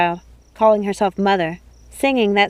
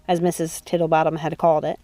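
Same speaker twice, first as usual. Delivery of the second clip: usual speaking voice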